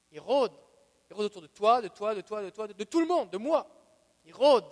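loud, insistent warning that builds; preaching at full force. Acted. Preacher to his church, warning that the devil prowls, full force. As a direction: hammer the warning at the congregation.

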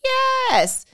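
loud high mock-sassy lecture; sassy Holy Spirit. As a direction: wag a finger in a high sassy voice. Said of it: "He was like".

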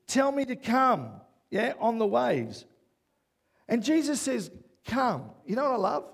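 loud, high, driving sermon delivery; preaching at full force. Acted.

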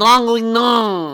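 Draguignan drawn out slow in an exaggerated French voice; mock French. Shown as a demonstration. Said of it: "Let me see it".

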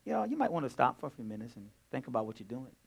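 soft, fast and offhand; mock-casual, no urgency; too casual. Shown as a demonstration. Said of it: "No, you can't do that".

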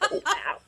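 high, breathy burst of delighted laughter; high giddy laugh. Real, not acted. Caller hearing she made the drawing, laughing giddily. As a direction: let the joy spill out as a laugh.

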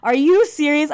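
loud, high disbelief; shocked and loud; incredulous. Retold or imitated. Retelling her reaction to the boyfriend, loud and incredulous.

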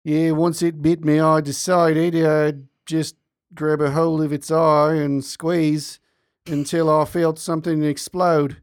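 slow, deadpan Australian drawl; lightly voiced calm Australian, slow and unbothered; Australian accent. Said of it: "with a very thick accent".

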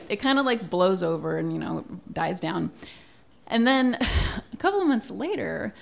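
ordinary talk

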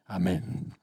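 soft breathy closing amen; whispered amen. Real, not acted. Preacher ends the Bible story with a whispered amen. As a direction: close the story in a hush.

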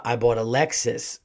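usual speaking voice

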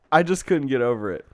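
ordinary talk, nothing marked

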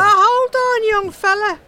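eager shout to stop him; calling out. Acted. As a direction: catch him before he goes. Calling the young man back.

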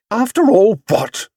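high, challenging retort; firm. A narrator's reading. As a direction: cut in firmly, pitched high. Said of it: "Missus Brown's voice had a firm note".

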